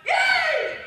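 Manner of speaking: high, loud cheer; cheering yay. Acted. The stuck-together people cheering as the spell breaks, high shout. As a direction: shout it out like a freed crowd. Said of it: "they all went".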